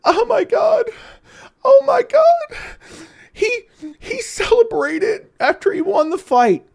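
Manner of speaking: very high, fake-sobbing whine of a son; mock crying child. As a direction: wail it like a crying kid. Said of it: "and said".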